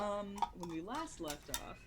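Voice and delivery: ordinary delivery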